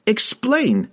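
high, sharp command; demanding. A narrator's reading. Worried wizard orders the halfling, demanding. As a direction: demand it at once. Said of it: "Kessel demanded".

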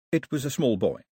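ordinary delivery, nothing marked

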